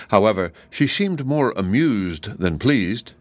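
ordinary delivery

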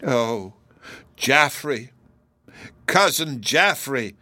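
understated, sorrowful appeal to a cousin; a touch of grieving reproach; mournful cry. A narrator's reading. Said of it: "mournfully, not passionately".

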